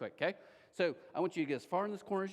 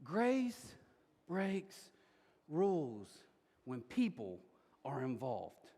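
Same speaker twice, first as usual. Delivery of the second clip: each word set apart with pauses; slow, word-by-word emphasis; 5 semitones lower, 4 dB quieter, slower than usual. Real, not acted. Preacher drives home the point to the congregation, slow emphatic delivery. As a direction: let every word land slowly. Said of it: "feel this for a second".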